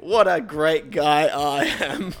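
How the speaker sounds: slow, self-satisfied boast; smug. Pretend. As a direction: savour your own greatness.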